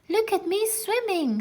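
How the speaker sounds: a proud little one showing off; high proud squeak of a small child; squeaky little Roo. A narrator's reading. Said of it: "squeaked Roo".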